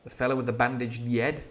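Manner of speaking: "yed" with a y, not h; "yed" for head in a Northern English accent. Shown as a demonstration. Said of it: "I pronounced head as head".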